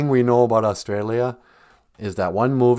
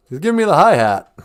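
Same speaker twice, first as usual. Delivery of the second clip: lightly voiced old-timer, louder; mock 1940s complaint; imitating wartime elders; 4 semitones higher, 7 dB louder than usual. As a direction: echo the old slang, raised and loud. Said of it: "World War Two elders' way they talked".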